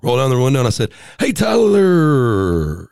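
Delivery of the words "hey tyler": drawn-out friendly greeting. Retold or imitated. Greets cop thinking it's his friend.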